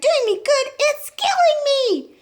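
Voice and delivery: high, loud, shrieking protest; outraged scream. A narrator's reading. Grandma protesting the medicine to boy, outraged scream. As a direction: scream it, stretching the last words. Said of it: "she screamed".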